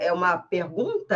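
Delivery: "pergunta" swept up at the end like a question; rising question tune on "pergunta"; question intonation. Shown as a demonstration. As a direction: demonstrate a question with a sharp rise on "pergunta". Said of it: "questão das entonações".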